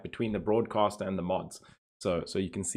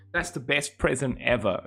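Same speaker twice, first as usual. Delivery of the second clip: normal talking voice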